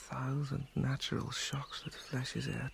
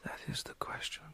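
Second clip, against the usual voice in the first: whispering. Acted. Whispered soliloquy.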